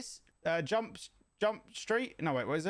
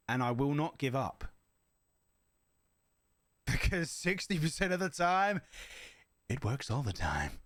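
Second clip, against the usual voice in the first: Anchorman quote; 5 semitones lower than usual. Retold or imitated. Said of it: "We try that again".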